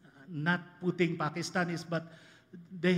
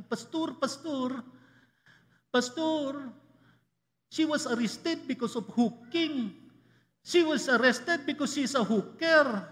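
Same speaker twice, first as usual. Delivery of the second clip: urgent Filipina English, higher and pleading, "pastur"; Filipino accent; 7 semitones higher, 3 dB louder than usual. Retold or imitated. OFW sister begs her pastor for help, Filipino accent. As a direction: imitate the worried sister's Filipino accent, pitched up. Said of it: "I'm sorry to use her accent".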